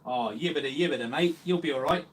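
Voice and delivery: loud, hammed-up Australian accent with rising ends; jokey Aussie twang, Australian accent pushed hard; mock Australian accent. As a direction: tease in an over-the-top Australian accent. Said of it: "taking the piss now, uh, in an Austra-, Australian accent".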